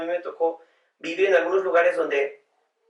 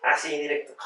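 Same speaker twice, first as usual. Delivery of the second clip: ordinary talk, nothing marked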